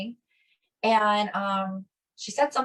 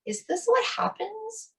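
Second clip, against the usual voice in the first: amazed wonder; 4 semitones higher, slower than usual. Retold or imitated. Amazed at kind response.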